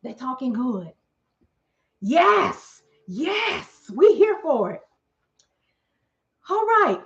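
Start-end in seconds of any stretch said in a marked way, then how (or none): 2.0-4.8 excited yes. Retold or imitated. Cheering the good talk.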